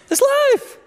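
It's life. pitched very high and squeaky, falling. Shown as a demonstration. Preacher repeats the line in a comic high squeak.

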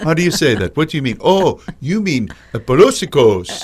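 playful, exaggerated French lilt; crazy French accent. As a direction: put on a silly French accent. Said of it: "that's my crazy French accent".

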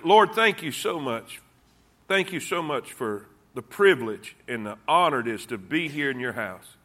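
soft, low opening prayer. Acted. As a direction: pray quietly to the Lord. Pastor leads opening prayer.

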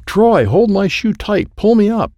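high, drawn-out urgent calling; urgent plea. Acted. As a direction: call up urgently while dangling from a hole. Dangling father calls for help.

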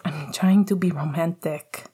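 ordinary delivery

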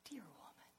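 soft loving whisper; Jesus whispering with great love; tender whisper. Retold or imitated. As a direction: breathe it like a lover's endearment. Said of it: "the tone in his voice".

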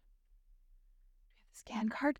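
hushed whisper at the door. Real, not acted. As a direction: breathe it, almost no voice.

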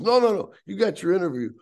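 high, loud imitated reassurance; yelling, hard of hearing. Half-deaf club boss assuring caller of interview, yelling. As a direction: cut in loudly down the phone. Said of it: "he's yelling at me 'cause he's sort of deaf".

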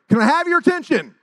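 loud, high shout to a crowd; calling out. Retold or imitated. Teenager addressing a noisy school crowd, calling out. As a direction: holler it over the noise.

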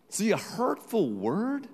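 usual speaking voice

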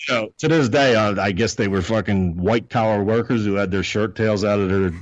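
ordinary delivery

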